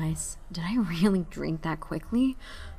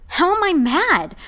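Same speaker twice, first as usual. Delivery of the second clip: loud and slow, high with disbelief; indignant shout; 11 semitones higher, 9 dB louder than usual. Acted. To the raven, a slow disbelieving shout. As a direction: demand an answer, shouting.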